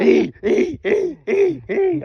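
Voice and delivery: high, rising squeaks imitating the bed creaking; hammed-up high squeaky ee ee; mock squeaky ee noises. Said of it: "He said".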